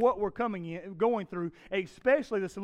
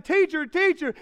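loud, high panicked cry; yelling; 9 semitones higher, 9 dB louder than usual. Retold or imitated. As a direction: call out over crashing waves. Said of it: "another disciple yelling".

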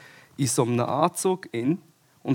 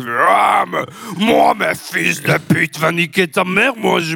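loud mock French shouting; French accent; 5 semitones higher, 5 dB louder, slower than usual. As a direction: rant like an angry Frenchman. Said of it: "wie so ein Franzose".

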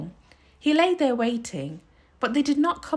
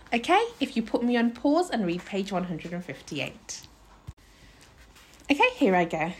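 ordinary delivery, nothing marked

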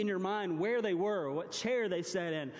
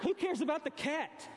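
mock indignant; 9 semitones higher than usual. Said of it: "she's like".

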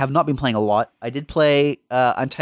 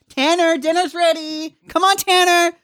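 put-on calling kids to dinner; 3 dB louder than usual. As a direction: call the kid in like a bogan mum. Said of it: "the real, real bogan name".

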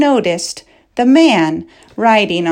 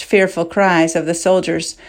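ordinary delivery, nothing marked

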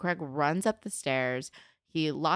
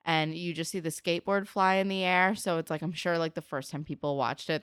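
normal talking voice

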